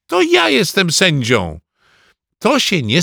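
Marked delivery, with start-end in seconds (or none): 0.1-1.6 put-on high, insistent boyish claim; indignant cry. A narrator's reading. Ananiasz claiming his role as referee, indignant cry. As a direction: insist in a raised pitch. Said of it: "krzyknął Ananiasz".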